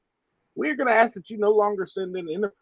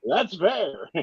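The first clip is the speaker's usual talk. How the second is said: ordinary talk, nothing marked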